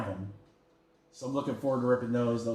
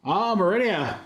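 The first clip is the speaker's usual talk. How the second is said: ordinary talk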